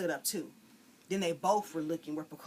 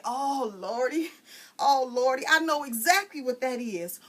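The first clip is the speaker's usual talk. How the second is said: knowing exclamation; 7 semitones higher, 8 dB louder than usual. Acted. Frida exclaims to the girls, knowing and loud. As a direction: draw it out like a know-it-all.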